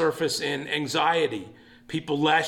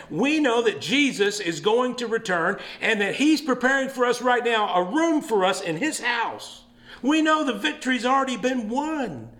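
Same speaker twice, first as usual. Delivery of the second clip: rousing preaching; 6 semitones higher than usual. Acted. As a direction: preach it to the back row. Preaching builds to full force.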